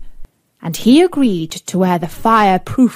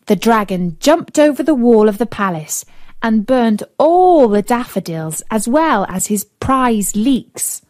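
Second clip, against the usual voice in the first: ordinary delivery, nothing marked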